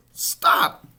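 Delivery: whispered-breath scream, very loud. A narrator's reading. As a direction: cut him off with a desperate scream. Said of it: "screamed Wilbur".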